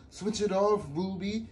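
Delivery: high, loud group shout; children shouting at a loud girl. Acted. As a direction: yell back at her to stop. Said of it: "They all turned around and shouted".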